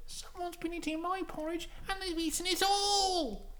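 very high little bear voice; tiny falsetto cub, drawing out the end; Baby Bear. Acted. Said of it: "Baby Bear goes".

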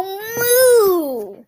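playful mooing punchline, very slow; drawn-out moo. Pretend. Punchline of a knock knock joke, a slow mooing cow.

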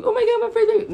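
high-pitched doting squeal of a parent; mock gushing. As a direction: gush over a first baby in a high voice. Gushing over her first baby.

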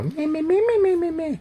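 high mock bleating; mocking whine. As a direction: tease her complaining back at her. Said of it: "I love doing that to Tommy when she starts complaining".

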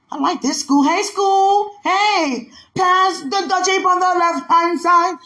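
high, loud, drawn-out holler; excited calling out. Retold or imitated. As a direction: holler it high across the schoolyard. Acting out excitement at school, calling out.

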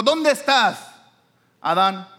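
high, loud call; God calling out. Acted. God calling Adam who hid from him, loud high call. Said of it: "Cuando vino y le dijo".

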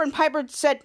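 ordinary delivery, nothing marked

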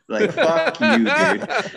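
high, loud mock fury; mock outrage. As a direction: squeal it like a furious friend. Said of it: "I was like".

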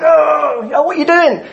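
high mock yell at another driver; angry driver. Preacher voicing an angry driver beeping the horn, mock yell. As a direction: blast it out like you're beeping your horn. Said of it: "we get kind of all angry".

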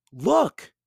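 high, loud call; excited girl. A narrator's reading. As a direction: point and shout it.

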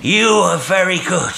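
high, loud, grateful call from afar; exclaimed thanks. A narrator's reading. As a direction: cry out thanks across the water. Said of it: "exclaimed the voice".

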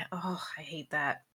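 soft disgusted whisper. Real, not acted. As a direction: breathe it out in disgust.

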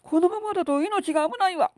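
high, frantic panicking wife; panicked wife. Acted. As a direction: shriek in panic at the blood. Wife panics at bleeding hand.

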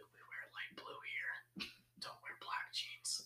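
slow, creepy whisper; hushed close-talking manager impression; imitated close talker. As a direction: whisper it slowly into their face. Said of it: "He was a close talker".

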